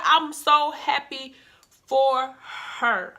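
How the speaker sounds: slow, emphatic happiness; I'm so happy for her, drawn out slow. Real, not acted. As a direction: stretch each word out slowly, savouring it. Repeats the line, first drawn out.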